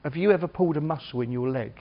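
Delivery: ordinary talk, nothing marked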